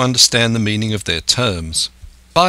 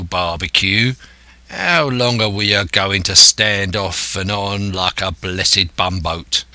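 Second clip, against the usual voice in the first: grumbling sailor in broad dialect; disgruntled coxswain. Acted. Coxswain impatient with the captain, grumbling to Silver. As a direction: complain like a fed-up deckhand.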